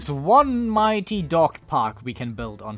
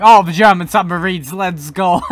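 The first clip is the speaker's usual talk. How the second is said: loud, delighted cheer breaking into a laugh; gleeful; 10 dB louder than usual. Real, not acted. Gamer cheering German submarines against the UK, loud gleeful laugh.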